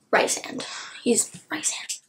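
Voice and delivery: soft whispered insistence. Real, not acted. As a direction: whisper the name fondly. Affectionate insistence on name.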